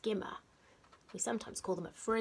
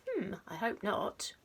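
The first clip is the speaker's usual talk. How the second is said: ordinary delivery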